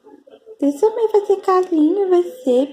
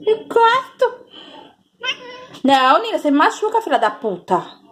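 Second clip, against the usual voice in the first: ordinary delivery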